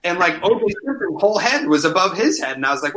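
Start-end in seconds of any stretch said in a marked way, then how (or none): none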